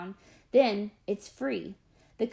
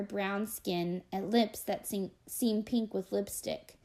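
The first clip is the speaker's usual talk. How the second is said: usual speaking voice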